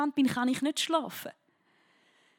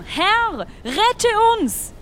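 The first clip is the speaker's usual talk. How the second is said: higher, louder plea for rescue; frightened cry; 8 semitones higher, 8 dB louder, much slower than usual. Acted. Disciples calling to Jesus in the storm, frightened loud plea. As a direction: cry out to be saved. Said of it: "riefen sie aufgeregt".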